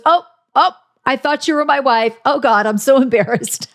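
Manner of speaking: high, embarrassed flustered voice; flustered Jason impression. Jason apologizing to a woman he mistook for his wife, flustered. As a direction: play him caught out and mortified. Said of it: "and he goes".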